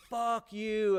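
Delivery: high mock-tough taunt; defiant yell. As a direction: throw it at him again, trying to sound bad.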